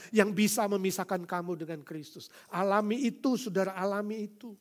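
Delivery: ordinary delivery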